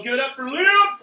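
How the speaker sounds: high, loud hype-up; rousing applause call. Real, not acted. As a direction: whip the crowd up for the winner. Calling for applause.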